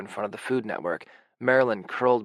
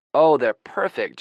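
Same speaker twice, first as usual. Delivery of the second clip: slow, louder call across the house; 3 semitones higher, 7 dB louder, slower than usual. A narrator's reading. Ginger calling from the kitchen, slow and louder. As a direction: call it out warmly from the next room. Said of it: "Ginger calls from the kitchen".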